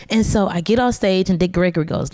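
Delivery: ordinary talk, nothing marked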